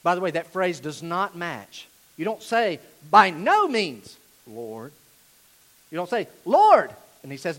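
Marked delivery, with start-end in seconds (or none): 3.1-4.2 loud, drawn-out mock refusal; emphatic refusal. Acting Peter's flat refusal. 4.5-4.9 low, soft deference; meek afterthought. Acted. Contrasting submissive title with refusal. 6.4-6.9 loud, high mock call; emphatic address. Acting an emphatic address to Lord.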